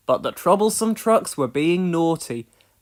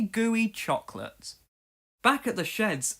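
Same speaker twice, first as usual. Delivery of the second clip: plain reading voice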